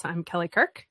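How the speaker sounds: ordinary talk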